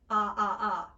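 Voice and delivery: open, loud American ah. Shown as a demonstration. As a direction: drop the jaw for a wide ah. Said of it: "standard Americans use it".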